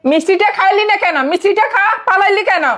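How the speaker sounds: high, taunting jeer; mocking taunt. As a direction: sneer at her from above. Maid taunting madam. (উঁচু গলায় ব্যঙ্গ করে খোঁচা)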